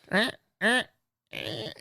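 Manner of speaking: mock straining grunts. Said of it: "just grabs it and just goes".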